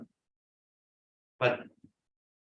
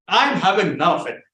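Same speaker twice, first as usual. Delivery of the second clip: loud refusal; 3 semitones higher, 7 dB louder, faster than usual. Retold or imitated. Refusing to ride the horse.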